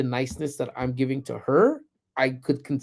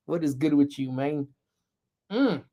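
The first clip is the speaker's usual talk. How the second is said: ordinary talk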